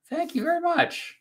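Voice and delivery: very high squeaky thank you. Acted. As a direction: jump way up high on "very" and fall. Thanks a viewer playfully.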